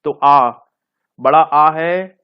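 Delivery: आ drawn out long and loud for the class; vowel demo. Shown as a demonstration. Teacher sounds out the vowel आ for children, loud and long. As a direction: sound out आ clearly and loudly. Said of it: "जैसे आम लिखना है".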